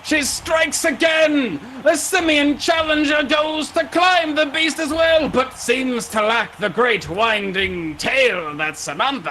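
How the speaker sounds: high, shouted commentary on the fight; Vin calling out. Acted. Vin calling the fight against the snake, high announcer shout. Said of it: "as you hear Vin call out".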